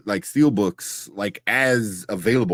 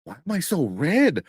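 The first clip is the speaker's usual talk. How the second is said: higher-pitched mock confusion; baffled questions, pitched up; retold bewilderment; 9 semitones higher than usual. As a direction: act out puzzling over yourself. Retelling own drunken, sunburnt bewilderment.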